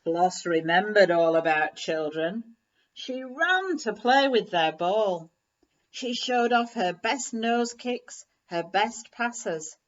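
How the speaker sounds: ordinary delivery, nothing marked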